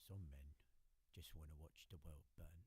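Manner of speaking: low, gruff Cockney gangster voice; Cockney accent. Pretend. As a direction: drop into a menacing Cockney growl. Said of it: "Should be on Peaky Blinders".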